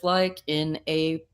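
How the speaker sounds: ordinary talk, nothing marked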